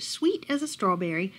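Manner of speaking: plain reading voice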